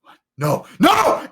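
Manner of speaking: a sudden high, startled protest; alarmed cry. Acted. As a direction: yelp it out in shock. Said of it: "He's like".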